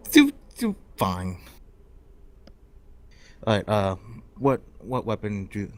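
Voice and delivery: ordinary delivery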